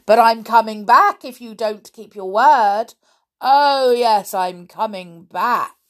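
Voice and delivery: loud, booming threat; suddenly loud. A narrator's reading. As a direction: burst out in a frightening warning. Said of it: "it got loud again".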